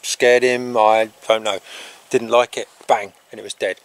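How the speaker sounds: normal talking voice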